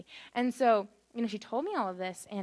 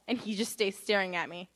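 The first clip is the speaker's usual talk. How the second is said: ordinary delivery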